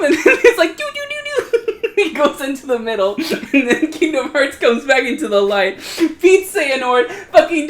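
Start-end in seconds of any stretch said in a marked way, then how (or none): 0.8-1.3 high, quiet giggling do-do-do; hummed tune. Retold or imitated. Retelling the ending to a friend, hums a high tune through giggles. Mimics music, laughing.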